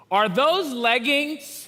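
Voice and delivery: mock a kid's blunt question; niece's question. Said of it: "my niece looks at me and says the following".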